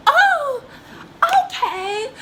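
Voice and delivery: very high, loud squeal; hammed-up high whimper; mock-tearful reaction. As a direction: imitate a parent reacting in a squeaky crying voice. Mom's reaction amid the crying.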